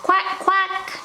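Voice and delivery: high quacking; duck quack. Shown as a demonstration. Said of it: "what a duck says".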